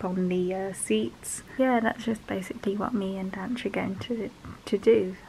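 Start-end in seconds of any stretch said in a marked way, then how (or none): 1.6-4.3 tired hushed whisper. Real, not acted. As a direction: whisper it softly.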